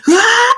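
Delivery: loud, high attacking yell; battle scream. Acted. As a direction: scream as you charge at them. Child lunges at attackers.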